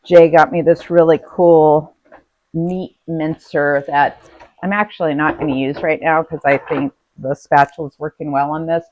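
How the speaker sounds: normal talking voice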